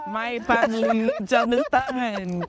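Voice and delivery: put-on playful Jamaican accent. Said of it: "you're talking like that".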